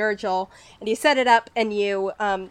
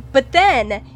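usual speaking voice

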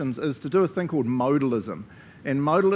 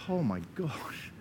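awestruck; 5 dB quieter than usual. Acted. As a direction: act out being amazed, stretching the opening words. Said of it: "I wanted us to go".

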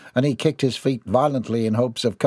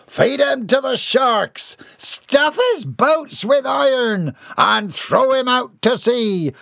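high, slow pirate shouting; bay for the captive's doom; bloodthirsty pirate; 12 semitones higher, 4 dB louder, slower than usual. Acted.

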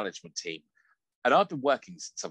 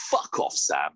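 gruff coworker; 9 semitones lower, slower than usual. Retold or imitated. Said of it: "He's like".

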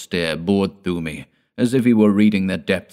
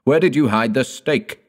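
high, loud furious demand; frantic furious cry; 7 semitones higher, 5 dB louder than usual. Acted. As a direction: shout the question at them. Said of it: "he cried".

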